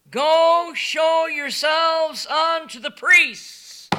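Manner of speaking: slow solemn loud command; Jesus commanding. Acted. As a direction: give the order slowly and clearly. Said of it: "now get this".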